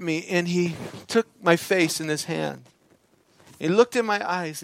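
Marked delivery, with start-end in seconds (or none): none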